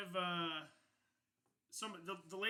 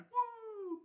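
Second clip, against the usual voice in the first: crowd cheer; 5 dB louder than usual. Acted. Crowd cheers after the announcement.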